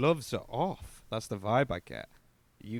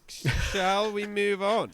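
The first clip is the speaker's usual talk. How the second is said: high, loud, slow announcement; theatrical prompt; 8 semitones higher, 5 dB louder, much slower than usual. Real, not acted. Co-host moving the review on, theatrical. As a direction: draw it out grandly to change the subject. Theatrical move to next song.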